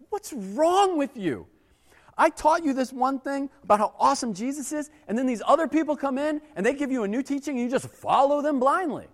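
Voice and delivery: mock exasperated rebuke, pitched high; scolding Paul. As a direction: lay into them like a fed-up parent. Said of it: "laying into this church".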